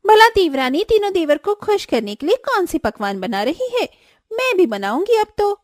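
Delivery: high, jealous elder sister-in-law; jealous Swati. Acted.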